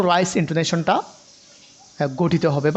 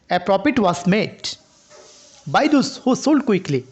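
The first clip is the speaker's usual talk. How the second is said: very slow, "profit" and "sold" weighted with a falling tune; slow model reading; much slower than usual. Shown as a demonstration. Teacher demonstrating the first meaning, slow and deliberate. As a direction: read it word by word, falling on "sold". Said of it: "প্রথম number যেটা এক নাম্বারে ছিল".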